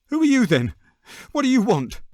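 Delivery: high, startled and quavering; quavering, frightened. A narrator's reading. Startled little man asking the stranger who he is, quavering. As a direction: ask it as a man caught out and scared. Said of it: "he asked in a quavering voice".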